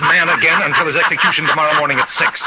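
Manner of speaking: possessed warden giving orders, manic and laughing. Acted. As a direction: order the guard about with unhinged glee.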